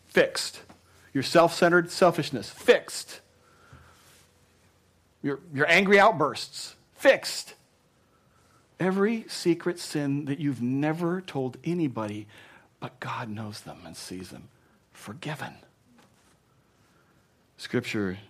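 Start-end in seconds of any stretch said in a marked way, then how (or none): 0.1-7.5 rising exultation. Real, not acted. Listing forgiven sins. 12.8-15.5 much softer, near a whisper; hushed. Real, not acted. Pastor telling the church secret sins are forgiven, hushed.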